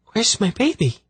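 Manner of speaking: put-on high, anxious cry for her baby; worried mother. A narrator's reading. Tenedra suddenly hysterical over baby.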